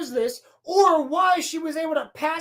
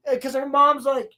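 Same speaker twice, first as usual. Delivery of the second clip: usual speaking voice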